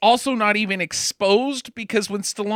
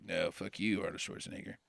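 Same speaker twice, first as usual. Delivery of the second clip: low, much softer menacing aside; mock villain hiss; 12 semitones lower, 17 dB quieter, faster than usual. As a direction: breathe it out like a beaten villain. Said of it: "He's like".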